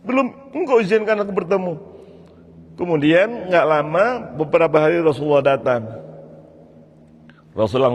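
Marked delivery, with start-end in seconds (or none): 0.0-1.8 high, strained, tearful plea; acted crying, voice pitched way up; weeping prayer. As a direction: plead to God through imitated tears. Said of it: "sambil menangis".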